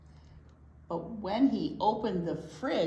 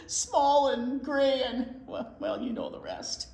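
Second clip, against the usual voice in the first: usual speaking voice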